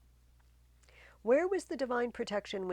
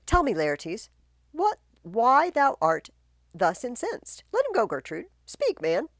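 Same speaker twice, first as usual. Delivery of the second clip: loud, high questioning; commanding king; 6 semitones higher, 9 dB louder than usual. Acted. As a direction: demand the man speak.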